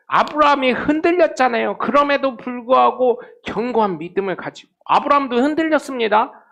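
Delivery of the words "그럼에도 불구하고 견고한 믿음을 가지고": ordinary delivery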